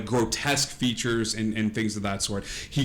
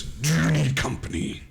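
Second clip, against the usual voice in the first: Pyre's voice; 5 semitones higher than usual. Acted. Game master voices Pyre.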